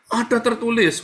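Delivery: high-pitched mock proclamation; imitating the devil. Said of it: "katanya setan ya kan".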